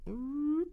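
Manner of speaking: high hummed piano noise; drawn-out high "mm" as a piano sound effect; imitated piano sound. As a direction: hum a high mock piano run.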